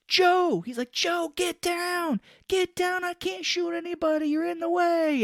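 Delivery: hammed-up gunfight panic; mock panicked yell. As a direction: yell at him to get out of the line. Writer shouting at Joe in gunfight.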